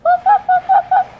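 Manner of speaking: very high, loud yappy woofs; very high yapping woofs; barking dog. Acted. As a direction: bark like a small dog. Dog barks again.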